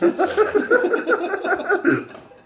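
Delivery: loud high real laugh; high laughing fit. Real, not acted. Reader laughing after six six six. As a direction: crack up again.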